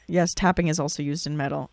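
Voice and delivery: ordinary delivery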